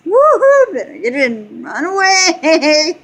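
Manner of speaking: a high loud whoop, then laughing out run away; whooping husband. Retold or imitated. Husband gleefully warning family after his fart, whooping and laughing. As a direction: cheer like a proud prankster, then flee laughing.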